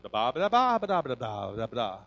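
lightly voiced baby babble; baby babble imitation. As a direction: babble like a baby learning to talk. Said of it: "He would talk and like".

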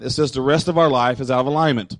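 ordinary delivery

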